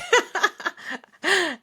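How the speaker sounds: loud, high-pitched real laugh; sudden high peal of laughter; burst of laughter. Real, not acted. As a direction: laugh out loud at your own joke. Laughs at her own dog leg joke.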